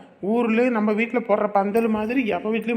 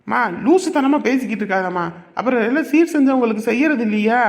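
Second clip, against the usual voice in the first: angry retort; 4 semitones higher, 5 dB louder than usual. Acted. Heated quarrel with mother.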